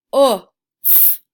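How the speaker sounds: "H-o-f" broken into sounds, loud; sounding out letters. Shown as a demonstration. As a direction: segment "H-o-f" clearly and loudly. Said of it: "let's pronounce the word".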